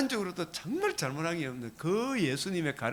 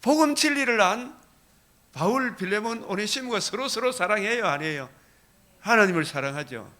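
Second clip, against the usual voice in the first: louder and higher, fervent preaching cadence; preaching at full force; 4 semitones higher, 7 dB louder than usual. Acted. Pastor urging the congregation on love of God, full-force preaching. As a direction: drive each phrase home with rising fervor.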